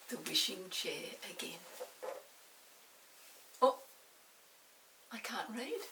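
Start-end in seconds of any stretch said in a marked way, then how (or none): none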